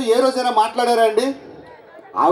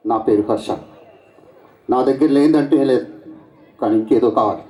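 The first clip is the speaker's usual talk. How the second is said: deep-pitched star hero dialogue; a mimic's lowered hero voice; Mahesh Babu impression; 10 semitones lower than usual. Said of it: "ఇప్పుడు చేసింది Mahesh Babu voice".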